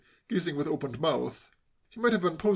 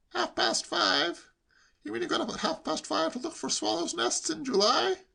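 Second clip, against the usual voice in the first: high-pitched amazed questioning; incredulous nephew; 14 semitones higher than usual. A narrator's reading. Nephew astonished at uncle's early rising, incredulous. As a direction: repeat it high, scarcely believing.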